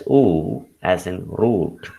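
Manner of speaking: oo drawn out slowly, as in root; lengthened vowel in oo and root; long oo. Shown as a demonstration. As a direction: hold the oo long, then root. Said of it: "It is a longer form of".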